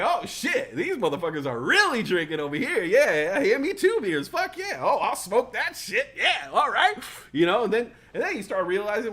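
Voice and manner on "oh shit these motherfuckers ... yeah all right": louder, eager party hype; excited. Retold or imitated. Joining the younger partiers, loud excited hype. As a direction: get pumped about the beers.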